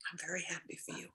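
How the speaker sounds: whispered aside. Acted. Mother Nature murmuring to the bird, whispered. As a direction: breathe it out unvoiced.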